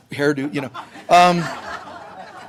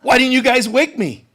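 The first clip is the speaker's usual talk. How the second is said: louder, higher, hammed-up alarm; mock panic; 5 semitones higher, 6 dB louder than usual. Jesus wrongly imagined waking up panicked, louder. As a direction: overplay a startled sleeper. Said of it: "he woke up and went".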